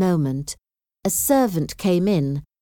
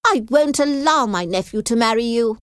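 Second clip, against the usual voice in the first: raised, higher voice, short of yelling; mild shout; 6 semitones higher, 4 dB louder than usual. A narrator's reading. Aunt forbidding the marriage, mildly shouted. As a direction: forbid it forcefully, with restrained volume. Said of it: "Lady Catherine shouted".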